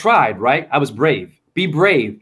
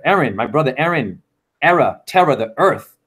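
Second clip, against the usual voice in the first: ordinary talk, nothing marked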